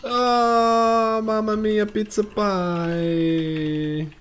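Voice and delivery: high, slow hammed-up Italian chef; jolly italian pizza chef. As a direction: sing out mamma mia like a cartoon chef. Cartoon Italian exclamation.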